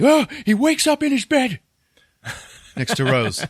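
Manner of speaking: loud, high-pitched mock panic; gasp, then yell it high as if jolting awake; startled outburst. Storyteller acts out nightmare waking, loud startled cry.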